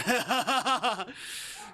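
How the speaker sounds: high, loud rolling cackle; burst of demon laughter. Acted. Demon bursts out laughing at his prey, gleeful. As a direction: burst out in big theatrical laughter. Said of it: "then he burst into laughter".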